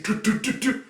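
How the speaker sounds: kissy toot noises. Retold or imitated. Anya puckering kiss noises.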